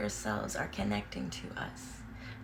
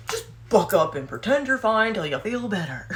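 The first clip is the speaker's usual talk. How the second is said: mock tough love; 4 semitones higher, 9 dB louder than usual. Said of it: "Would you be like".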